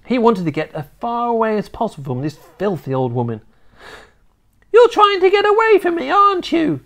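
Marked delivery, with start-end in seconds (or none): 4.7-6.8 put-on high, loud, fast old hag; accusing old witch. A narrator's reading. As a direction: snap it out like a nasty grandma. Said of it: "she said".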